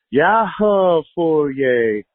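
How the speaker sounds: ordinary talk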